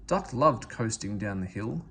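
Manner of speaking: usual speaking voice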